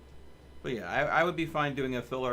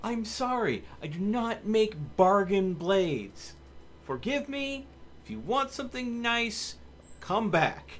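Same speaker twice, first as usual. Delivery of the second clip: indignant refusal; 9 semitones higher than usual. Retold or imitated. To customers begging for cheap swords, hammed-up indignant high voice. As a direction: scoff at the idea of selling cheap swords. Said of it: "I'm like".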